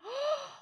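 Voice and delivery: big mock gasp of wonder; excited gasp. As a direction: gasp like a thrilled child. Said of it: "like, so excited".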